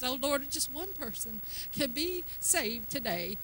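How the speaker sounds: soft, timid little plea; hushed, half-hearted mock prayer; mock religious prayer. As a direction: pray small, meek and pious. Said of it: "that's just religious thinking".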